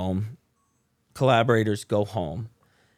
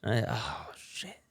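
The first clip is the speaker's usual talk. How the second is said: awed whisper; 6 dB quieter, slower than usual. Real, not acted. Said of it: "awed whisper".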